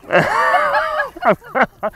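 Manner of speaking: high-pitched laughing fit; high, helpless laugh; laughter. Real, not acted. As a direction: keep cracking up between takes. Laughing between takes.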